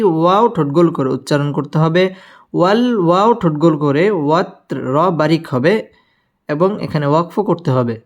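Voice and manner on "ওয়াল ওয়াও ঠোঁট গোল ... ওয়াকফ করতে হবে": ordinary talk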